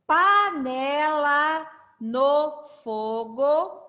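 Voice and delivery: very slow, word by word, syllables drawn out; leitura silabada. Shown as a demonstration. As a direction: read very slowly for learners to join in. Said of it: "Eu vou ler bem pausado". (leitura muito pausada, sílaba por sílaba)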